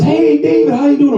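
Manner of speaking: high, bright mock relative's hello; cheery greeting. Relative greets him on vacation, high cheery call. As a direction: chirp it like a stranger hailing you. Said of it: "someone says".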